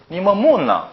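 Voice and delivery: Gyeongsang "니 뭐 묻나" with a high pitch peak, then a drop; brisk Gyeongsang question intonation; Gyeongsang accent. Shown as a demonstration. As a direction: ask it as a Gyeongsang local would. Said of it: "경상도 사람 읽으면 다르죠".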